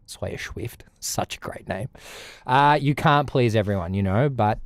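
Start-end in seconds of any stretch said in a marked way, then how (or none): 0.1-1.9 soft whisper savoring the name; whispered aside. Real, not acted. Streamer whispering a viewer's username, amused. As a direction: whisper it like a private joke.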